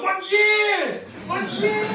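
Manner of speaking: high, loud robotic shout; Terminator shout. Retold or imitated. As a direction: bark it like the Terminator. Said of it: "Just like Terminator".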